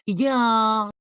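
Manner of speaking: Y'ALL stretched out in a mock Southern twang; mocking Southern accent. Imitating her mocking a viewer, exaggerated Southern accent. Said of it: "And she was mocking it".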